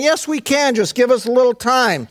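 high-pitched, hurried-sounding protest; acted high-voiced insistence; defensive pleading. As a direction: plead for another chance. Acting disciples pleading for time.

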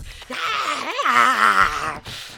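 loud, high possessed cackle; crazed laugh. Retold or imitated. Girl acting possessed in the car, wild loud cackle. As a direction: laugh like a girl gone demonic. Turning into Linda Blair, Exorcist.

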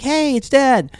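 high, happy puppy voice, lightly voiced; the excited puppy spotting his owner coming home; voicing the dog. Puppy owner voicing his excited dog, high and light. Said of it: "he just looks at me like".

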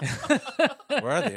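high, slow words through real laughter; laughing question. Real, not acted. Host asking through laughter, laughing hard.

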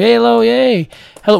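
high, loud call for attention. Retold or imitated. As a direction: call out to get noticed. Calling out to inattentive staff.

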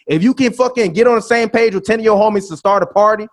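usual speaking voice

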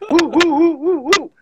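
imitated crowd whoops. Storyteller imitates squad moving the party, whooping. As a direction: whoop like a celebrating crew.

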